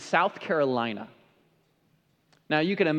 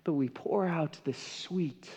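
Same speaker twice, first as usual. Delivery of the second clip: hushed preaching; 7 dB quieter than usual. Acted. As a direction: let the point settle into a hush. Hushed close to sermon point.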